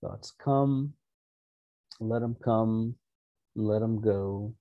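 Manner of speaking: soft-spoken relaxation voice; guided meditation. Real, not acted. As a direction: settle listeners gently, leaving room to breathe. Leads an opening meditation.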